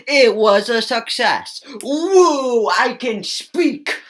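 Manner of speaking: slow, hammed-up cheer for I can speak; sarcastic slow victory cheer; mock triumph. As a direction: celebrate sarcastically. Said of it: "as you can clearly see".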